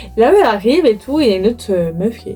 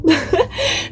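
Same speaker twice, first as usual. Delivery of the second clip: high laugh; 8 semitones higher than usual. Real, not acted.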